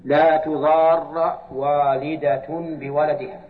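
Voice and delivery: slow, chanted recitation of the verse; slow Quranic recitation. Real, not acted. Teacher reciting the verse to students, slow and chanted. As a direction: draw out each word as in tilawa.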